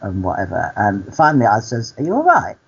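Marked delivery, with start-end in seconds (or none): 2.0-2.6 high-pitched retold query; acted concerned question. Storyteller replays asking the cleaner if she's okay, concerned question. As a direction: ask it high, lifting at the end.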